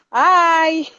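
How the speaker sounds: ordinary delivery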